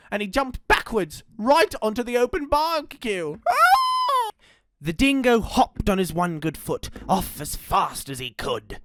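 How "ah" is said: very high, loud shriek of pain; pained scream. Acted. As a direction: scream as you burn. Dingo lands on barbecue.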